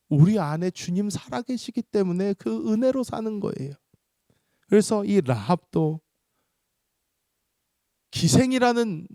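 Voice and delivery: high, loud, sustained exhortation; impassioned preaching. Real, not acted. Preacher exhorts the congregation, high and forceful. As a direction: drive each clause home to the congregation.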